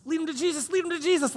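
high, rising plea to lead him to Jesus; mock urgent wife. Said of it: "is sitting there like".

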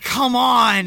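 a loud hammed-up come on; mock shout. Retelling the movie, Matt protests at Jenna, mock shouting.

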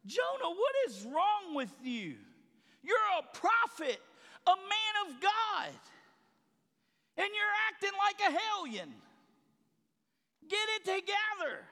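preacher scolding Jonah while reading the text; exasperated scolding. Retold or imitated. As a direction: shake a wayward prophet by the collar. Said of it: "I'm like".

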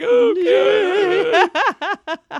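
high, loud hammed-up wail; mock crying. Man just threatened breaks down, mock crying. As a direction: imitate a crybaby, wailing high. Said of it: "And he just starts crying".

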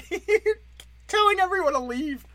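high-pitched, laughing disbelief; incredulous laughing. Real, not acted. Comic mocking the governor's order to leave, real incredulous laughter. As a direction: laugh through it, voice shooting way up.

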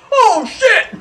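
loud high burst then laughter; laughing exclamation. Retold or imitated. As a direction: yelp it, then crack up. Friend falls through ice.

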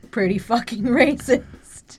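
laughing through the words; incredulous laugh mid-phrase; appalled laughter. Real, not acted. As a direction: say it slowly, breaking into a laugh.

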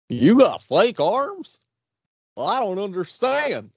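high, baffled cartoon goof; dopey voice swooping way up; Goofy. Puzzled by the creep's fake arms, high goofy voice. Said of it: "sound like Goofy".

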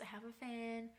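ordinary delivery

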